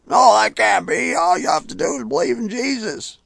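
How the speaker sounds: high, loud whiny protest; mock whining. Preacher mocking believers who whine at his message, high and whiny. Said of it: "whining and crying like".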